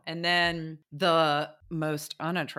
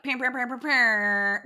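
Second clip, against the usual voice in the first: imitated horn blasts; 7 semitones higher, 5 dB louder than usual.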